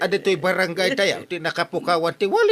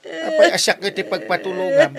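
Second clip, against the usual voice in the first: higher, whiny crying protest; mock crying; 8 semitones higher, 3 dB louder than usual. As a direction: whimper it back, half-joking. Crying back at the complainer. (agsangsangit nga agreklamo)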